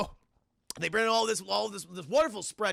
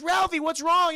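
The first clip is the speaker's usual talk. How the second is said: high, loud alarmed mother voice; worried mom; 10 semitones higher, 8 dB louder than usual. Retold or imitated. Mom calls to crying son.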